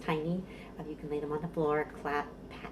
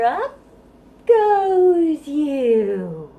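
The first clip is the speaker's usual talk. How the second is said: put-on high, loud cry as the baby goes up; lifting cheer; 9 semitones higher, 12 dB louder, much slower than usual. Librarian lifting babies at the top of the rhyme. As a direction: swing it up with a big happy cry.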